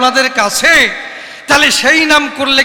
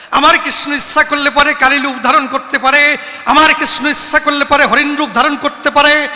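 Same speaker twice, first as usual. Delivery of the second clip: ordinary talk, nothing marked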